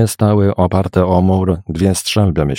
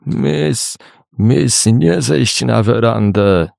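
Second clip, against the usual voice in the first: higher, slowed, hoarse character voice; 5 semitones higher, much slower than usual. A narrator's reading. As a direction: plead slowly in a put-on hoarse voice. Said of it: "odparł ochrypły głos Murzynki". (ochrypły, przeciągający głos służącej)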